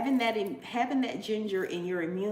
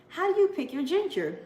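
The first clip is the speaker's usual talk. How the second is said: higher, lightly voiced asking shopper; curious shopper; 9 semitones higher, 3 dB louder than usual. Customers at the farmer's market asking her, lightly mimicked. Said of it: "people ask me at the store".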